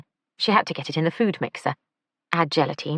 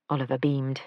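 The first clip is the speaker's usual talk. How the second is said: usual speaking voice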